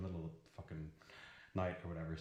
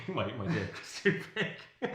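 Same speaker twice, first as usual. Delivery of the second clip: louder, higher, laughing through it; embarrassed laughing retelling; laughing repeat; 6 semitones higher, 8 dB louder than usual. Real, not acted. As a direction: repeat it for a listener who didn't catch it, cracking up.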